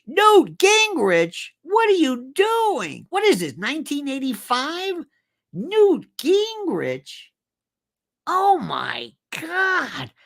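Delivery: can't believe what he's seeing; incredulous. Real, not acted. Disbelieving rant at cable news.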